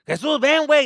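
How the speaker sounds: loud high call; dad calling out. Retold or imitated. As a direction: call him over.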